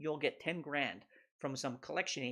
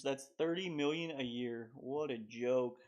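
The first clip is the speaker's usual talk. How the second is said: ordinary talk, nothing marked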